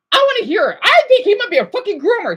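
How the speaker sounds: loud, high, agitated accusation; worked-up, high-pitched rant; heated outburst. Real, not acted.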